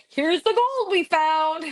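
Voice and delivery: high, loud, drawn-out mock discovery; excited kid. Young mind imagining a find, excited kid. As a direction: imitate a child thrilled by a big find. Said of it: "a young mind thinking that they're like".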